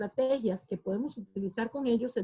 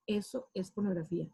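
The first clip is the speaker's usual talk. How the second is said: softer, lower-pitched label; hushed, lowered demonstration; 4 semitones lower, 6 dB quieter than usual. Shown as a demonstration. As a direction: say it quietly, as if labelling it to yourself. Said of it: "Cuando usted lo dice en voz baja".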